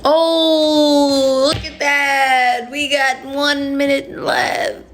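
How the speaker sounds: slow, high drawn-out cry; surprised exclaim. Real, not acted. Reacting to the stream timer running out. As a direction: call out the timer in alarm.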